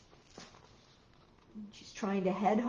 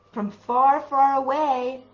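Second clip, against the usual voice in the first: slow, much louder storytelling; loud, drawn out; 2 semitones higher, 11 dB louder, slower than usual. A narrator's reading. Storyteller telling a child of the distant howl, loud and slow. Distant howls answer the cub.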